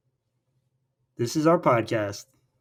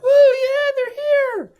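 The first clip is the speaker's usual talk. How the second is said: much higher, louder excited cheer; cheering crowd; 5 dB louder, much slower than usual. Retold or imitated. Crowd cheers the couple's arrival, high whoop. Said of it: "everyone's like".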